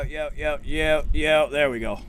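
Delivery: ordinary talk, nothing marked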